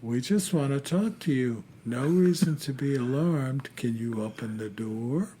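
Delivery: soft, creepy deadpan impression; slow deadpan. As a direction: imitate an eerie visitor, quiet and even. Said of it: "that slow, deadpan voice".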